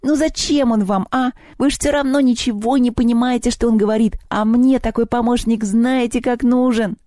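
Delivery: high, pleading goblin whine; put-upon little house spirit; whining goblin. A narrator's reading. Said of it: "захныкал кабинетный".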